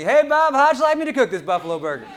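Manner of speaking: loud, high shout from the kitchen; cook yelling. Retold or imitated. Cook yelling to Bob from the kitchen, loud shout. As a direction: holler the question across the restaurant. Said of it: "The cook yells out to me".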